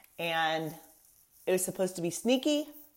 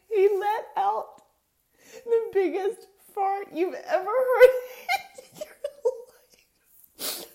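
squealing laughter, very high; laughing; 14 semitones higher, 6 dB louder than usual. Real, not acted. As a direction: laugh through the punchline. Cracking up at punchline.

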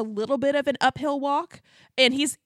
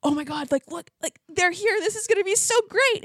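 higher-pitched retold excitement; mock-gushing, pitched up; excited friends; 9 semitones higher than usual. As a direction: imitate thrilled friends spotting someone. Retold excited friends spotting arrivals.

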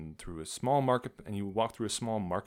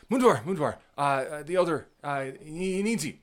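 loud, high, breathless call; urgent young elf; 7 semitones higher, 7 dB louder than usual. Acted. Young ranger runs to fetch Mundwar, loud and urgent. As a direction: rush in with urgent news. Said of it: "and he says".

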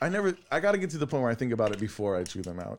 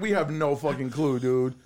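usual speaking voice

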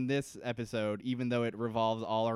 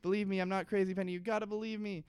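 high, frantic pleading through a door; desperate pleading; 8 semitones higher, faster than usual. Retold or imitated. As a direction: beg her to believe you, voice pitched way up. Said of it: "slamming on the door and being like".

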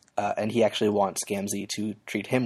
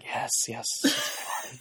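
hushed, breathy acted gratification; half-whispered creepy pleasure; creepy eager relish; 4 dB quieter than usual. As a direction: drop to a soft, gross whisper of satisfaction. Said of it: "And he's like".

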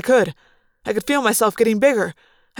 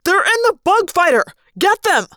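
high, loud, furious order; howl of rage; 10 semitones higher, 5 dB louder than usual. A narrator's reading. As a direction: howl for your men to attack. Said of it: "howl in rage".

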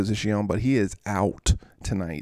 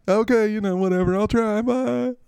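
higher, louder put-on shrug; player voice; 11 semitones higher, 3 dB louder than usual. [name] signing off, high put-on voice. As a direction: brush it off in character. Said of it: "Okay, Mister Reed".

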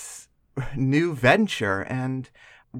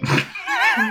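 laugh. Real, not acted. Laughing at slip Peastern.

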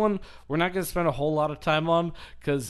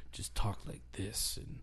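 lightly imitating a soft-spoken mumble, softer and lower; a hint of shy, softer talk; 4 semitones lower, 8 dB quieter than usual. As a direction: tone it way down, hiding the accent. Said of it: "it was very soft-spoken".

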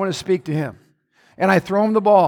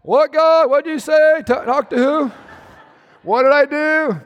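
indignant protest; 7 semitones higher, slower than usual. Retold or imitated. Defensive husband questioning God, higher incredulous voice. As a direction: protest in disbelief with a half laugh. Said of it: "Or you can go".

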